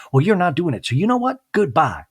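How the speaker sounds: mock indignant exit; hammed-up huffy goodbye. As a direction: flounce out of the room. Mary storming off in quarrel.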